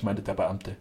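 plain reading voice

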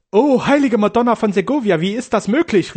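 high, loud outcry to the Madonna; nun crying out at the bad news; cried out. A narrator's reading. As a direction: cry out in pious dismay. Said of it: "rief Schwester Clarissa".

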